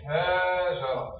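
هاجرة said very slow with a long first a; slow pronunciation demo. Shown as a demonstration. Teacher answering how to pronounce it, very slow. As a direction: draw out the ها of هاجرة. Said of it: "كيفاش ننطقها هذه؟".

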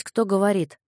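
ordinary delivery, nothing marked

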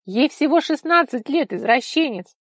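high, loud, triumphant denunciation; outraged accusation. A narrator's reading. Vika accuses Nikolai triumphantly. (возмущённое обвинение, громко)

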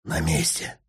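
low, breathy whisper; шёпот. Acted. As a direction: whisper it under your breath. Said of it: "прошептал". (тихий шёпот)

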